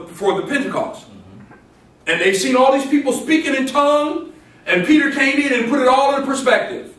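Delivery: high, loud preacher's proclamation; punchy, rising pulpit delivery; forceful preaching. Acted.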